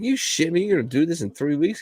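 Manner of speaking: high, fast disbelief; can't believe the wait; mock outraged disbelief; incredulous.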